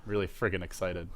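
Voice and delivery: low, hushed, deadpan claim of excitement; understated excitement. Pretend. Caster answers co-caster on how excited he is, understated. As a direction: say it softly, holding the excitement back.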